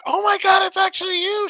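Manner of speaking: high, loud falsetto shriek; imitated fan scream. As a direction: squeal like a starstruck fan. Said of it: "She starts screaming".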